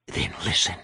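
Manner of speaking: low breathy ghost command; ghostly whisper. A narrator's reading. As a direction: hiss it like a ghost. Undead woman commands in whisper.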